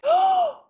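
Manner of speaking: a sudden shocked intake of breath, jumping high; real startled gasp at the screen; sharp gasp. Real, not acted.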